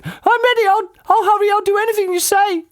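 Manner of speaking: put-on squeaky puzzled old lady; Missus Twit voice. A narrator's reading. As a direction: play her as a high, befuddled old wife.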